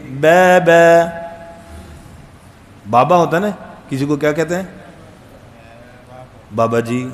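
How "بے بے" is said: بے بے drawn out slowly and louder; mock mispronunciation. Shown as a demonstration. Teacher mocking the wrong vowel in بابا. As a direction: say بے بے stretched in place of بابا. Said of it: "اگر اردو میں ہم بولیں".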